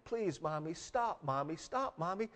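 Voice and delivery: soft, small mock whimper; imitated whimper. Little girl begging Mommy to stop, retold soft whimper. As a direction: plead quietly, imitating a worn-out hurt child. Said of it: "begins to just whimper a little bit".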